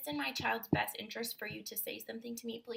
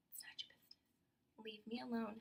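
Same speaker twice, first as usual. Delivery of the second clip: whispered dismissal; 9 dB quieter than usual. Real, not acted. Quiet dismissive ending.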